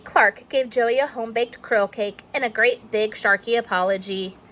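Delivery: usual speaking voice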